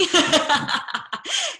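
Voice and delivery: bright high burst of laughter at her own joke; a real laugh, pitched well up. Real, not acted. Laughing at herself for sounding like a keynote, a high laugh.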